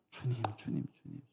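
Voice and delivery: anguished prayer. Retold or imitated. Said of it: "이 분노가 올라올 때".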